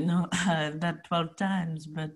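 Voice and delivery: slow, suave, laughing French charmer; smooth French guy. Retold or imitated. French guy flirting with Carrie, slow and suave. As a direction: drawl it out like a smooth seducer. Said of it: "And he's like".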